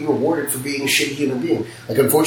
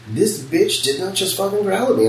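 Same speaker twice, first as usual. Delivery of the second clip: incredulous inner fury, pitched up; a flash of outrage in your head; 7 semitones higher than usual. Retold or imitated. Shop owner thinking about a growling customer, incredulous outrage. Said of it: "I was like, in my head".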